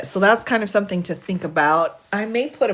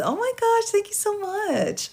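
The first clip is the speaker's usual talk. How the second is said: much higher gushing thank-you; delighted thanks. Real, not acted. Streamer thanking a viewer for a gift, high and delighted. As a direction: squeal your thanks for the gift.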